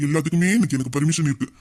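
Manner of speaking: ordinary talk, nothing marked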